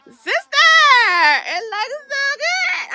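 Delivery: high, cracking squeal of delight; squealing excitement. Real, not acted. Vlogger gushing to viewers over her hair, in a high squeal. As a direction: squeal it way up like you can't believe it.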